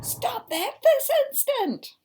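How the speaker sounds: furious shout. Acted. Mother reading the book, shouting at David. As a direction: yell it at full force.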